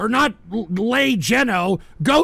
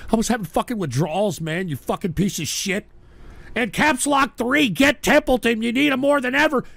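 ordinary talk, nothing marked

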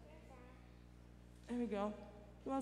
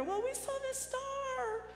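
wise men voice; 8 semitones higher than usual. Retold or imitated. Wise men answer in story.

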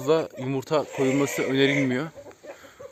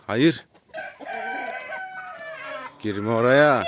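scolding a hen; 3 semitones higher, much slower than usual. Real, not acted. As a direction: stop the hen from going in. Scolding hen entering wrong coop.

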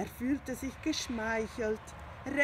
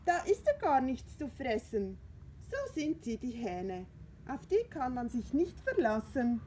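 indignant cat Findus; 4 semitones higher than usual. Acted. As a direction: scoff at the very idea of a rooster. Findus grumbling.

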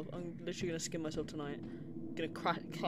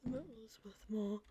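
whisper; 3 semitones higher than usual. Real, not acted. Whispered aside.

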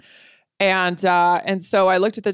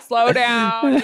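mock overwhelmed; 5 semitones higher than usual. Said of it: "and I'm like".

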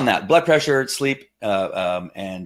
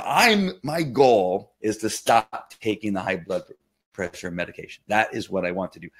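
ordinary talk, nothing marked

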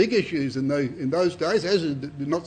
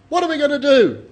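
higher, louder worried question; pleading question; 8 semitones higher, 8 dB louder than usual. Acted. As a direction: ask it like a frightened tax collector. Tax gatherers ask John.

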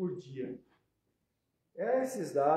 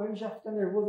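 ordinary talk

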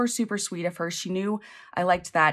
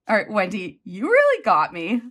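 usual speaking voice

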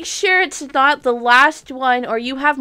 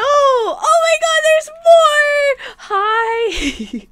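much higher, louder joyful cry; ecstatic shriek; 6 dB louder, much slower than usual. Real, not acted. Streamer seeing more pumpkin dragons, shrieking with joy. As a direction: shriek with delight.

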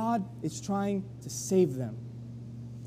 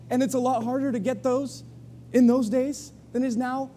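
usual speaking voice

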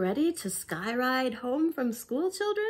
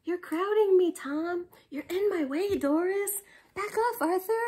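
squabbling complaints; 6 semitones higher, 4 dB louder than usual. Acted. Kids squabbling in crowd.

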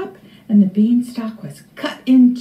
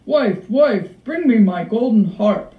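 emphatic, commanding giant; giant calling out. A narrator's reading. Giant orders his wife to fetch the harp, calling out. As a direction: call it out as an order. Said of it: "the giant called out".

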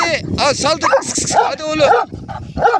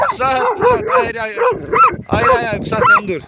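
high, urgent calls to stop the dog; sharp no, no to a lunging dog; alarmed calling to dog. Real, not acted. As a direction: owner stopping his dog from lunging at the snake, high and alarmed.

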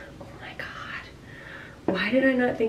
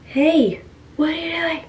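higher-pitched imitation of partner; imitating partner; 6 semitones higher than usual. Prankster imagining partner's arrival, mimicked greeting. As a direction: mimic her walking in and asking. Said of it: "she's gonna be like".

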